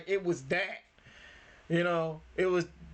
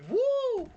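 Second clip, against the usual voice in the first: excited whoop; 5 dB louder than usual. Real, not acted. Hyped reaction to film scene.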